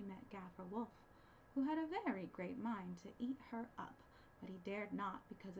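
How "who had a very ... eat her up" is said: plain reading voice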